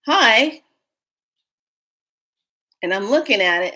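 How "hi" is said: startled hi. Retold or imitated. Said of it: "I'm like".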